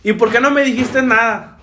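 mock maternal telling-off; imitated scolding mom. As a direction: demand it like an upset mother. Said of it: "me empezó a regañar".

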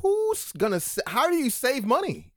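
high, exasperated disbelief; incredulous. Retold or imitated. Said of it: "And I'm like".

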